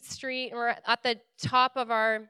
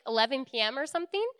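ordinary talk, nothing marked

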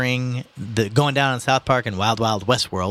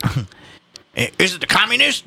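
put-on old man voice; 10 semitones higher, 4 dB louder, slower than usual.

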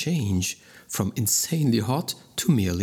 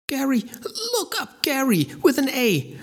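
high, hurried, breathless offer; a beaten thug eagerly giving up his boss; eager to help. A narrator's reading. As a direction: blurt it out to save himself. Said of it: "Fat Ugly volunteered".

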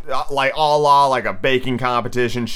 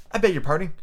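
higher-pitched, meek apology; humble apology; 6 semitones higher than usual. A narrator's reading. Alice apologises to the scolding mouse, humble. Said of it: "said Alice very humbly".

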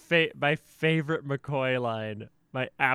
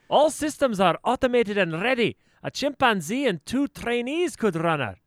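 higher, louder proud boast; Scotty line; 9 semitones higher, 5 dB louder than usual. Retold or imitated. Quoting Mister Scott bragging to Kirk about the ship, proud. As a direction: brag about the ship like an engineer. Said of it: "Scotty quoted".

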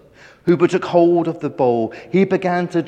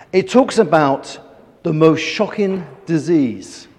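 plain reading voice

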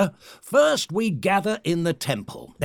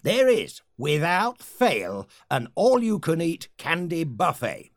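ordinary talk

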